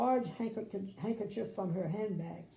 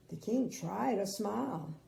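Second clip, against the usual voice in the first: ordinary delivery, nothing marked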